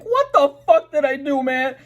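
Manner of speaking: very high, squeaky disbelief; shrill exasperated plea. Retold or imitated. Retelling being booked by the cops, a shrill exasperated plea. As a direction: wail it like it makes no sense.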